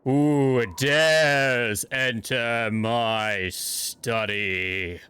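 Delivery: slow, booming spirit voice; deep voice. Acted. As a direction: drag it out like an ancient spirit. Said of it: "And then in a deep voice".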